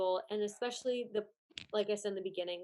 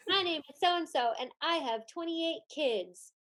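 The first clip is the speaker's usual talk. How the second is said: higher, louder mock contestant voice; pageant introduction; 5 semitones higher, 5 dB louder than usual. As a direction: announce yourself like a contestant onstage. Acting contestant introducing herself onstage.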